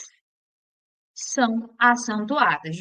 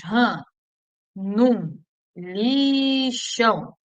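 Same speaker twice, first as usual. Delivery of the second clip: slow, stretched-out "ran, num, lixão"; joining the dots; much slower than usual. Shown as a demonstration. Teacher linking letters into a memory phrase, slowly drawn out. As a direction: draw out "lixão" on a long first syllable. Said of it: "é só você ligar os pontinhos".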